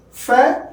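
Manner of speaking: teacher isolating the stressed syllable; isolated stressed syllable. Shown as a demonstration. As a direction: punch the syllable Fair hard. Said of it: "that is stressed".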